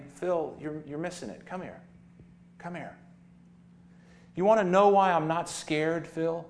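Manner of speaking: lightly voiced sheep letting you in on a secret. Sheep confides to preacher.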